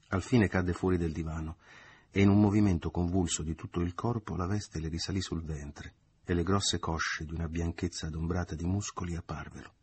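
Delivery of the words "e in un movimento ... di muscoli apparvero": usual speaking voice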